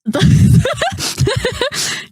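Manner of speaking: sudden real laugh; burst of laughter. Real, not acted. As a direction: laugh out at the joke. Co-host jokes about women racing.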